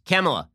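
mock-echo "Kamala" with a flat A as in camel; mimicked mispronunciation. Host mimicking Biden's slip, Kamala as CAM-uh-la.

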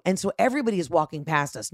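normal talking voice

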